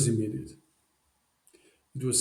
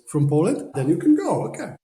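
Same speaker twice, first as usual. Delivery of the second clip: high, loud, amazed exclamation; mock delighted surprise at Poland; excited surprise; 6 semitones higher, 8 dB louder than usual. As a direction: play the host suddenly won over. Retelling Scott's delighted reaction.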